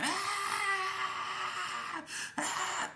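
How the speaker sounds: drawn-out high wail; retold scream. Storyteller acts out screaming on the ground after being dropped, high wail. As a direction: keep up a long, very high mock scream. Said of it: "I'm still screaming".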